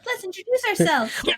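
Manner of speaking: high mock echo. Co-player mimics the line.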